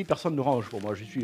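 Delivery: ordinary talk, nothing marked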